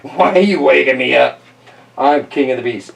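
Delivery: a touch of lion indignation; mildly grumpy lion. Acted. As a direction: grumble lightly like a woken king. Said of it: "He looks angry".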